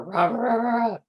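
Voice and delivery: loud begging grunts; begging bear. A narrator's reading. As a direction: beg like a hungry bear. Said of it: "The old bear begged".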